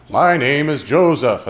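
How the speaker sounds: hammed-up solemn narrator; booming exhibit voice. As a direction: intone it like a museum recording. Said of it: "there's a loud voice".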